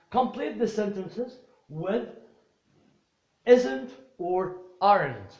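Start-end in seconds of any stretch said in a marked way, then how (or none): 3.4-5.3 "isn't or aren't" slow and louder; teacher highlights the two forms to use; stressed forms. Shown as a demonstration. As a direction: hammer "isn't" and "aren't" for the class. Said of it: "teacher stressing the target forms".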